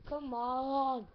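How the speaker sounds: normal talking voice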